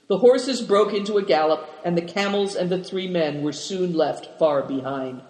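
ordinary delivery

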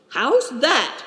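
high, brusque question. A narrator's reading. Leader questioning his companion's news, brusquely. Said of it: "asked Good Fortune brusquely".

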